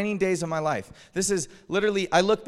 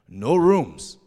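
loud, blunt refusal; mock curt clerk voice; imitated hotel clerk; 7 dB louder than usual. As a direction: mimic a clerk turning guests away. Retold hotel clerk refuses them.

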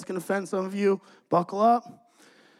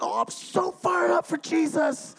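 high, slow, hammed-up zeal; mock an over-keen young believer, pitched up and drawn out; fired-up impression; 9 semitones higher than usual. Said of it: "he's all fired up. You know how Y wammers are".

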